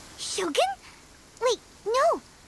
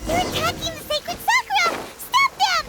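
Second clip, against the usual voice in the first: alarmed call; 5 semitones higher than usual. Acted. Paimon calling the alarm as monsters attack the Sakura, urgent higher shout. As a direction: raise the alarm as the attack begins.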